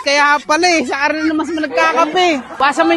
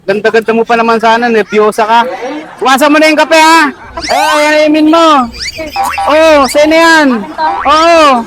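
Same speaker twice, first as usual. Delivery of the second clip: loud, pushy sales talk; loud ad pitch; 10 dB louder than usual. Acted. Selling soda to a nervous woman, loudly. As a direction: push the product loudly.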